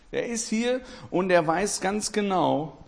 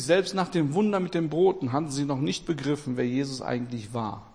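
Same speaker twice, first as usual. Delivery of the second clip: usual speaking voice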